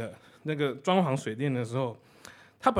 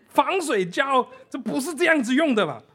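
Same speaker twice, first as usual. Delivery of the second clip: incredulous; 11 semitones higher, 6 dB louder than usual. Retold or imitated. Operator incredulous.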